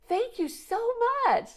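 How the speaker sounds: high, sing-song thank you to a rude driver; sweetly thanking. Retold or imitated. Thanking the glaring driver with sugary brightness. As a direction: kill him with kindness.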